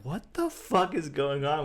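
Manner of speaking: high, drawn-out mock disbelief; retold bewilderment. Retold bewildered group reaction to commotion.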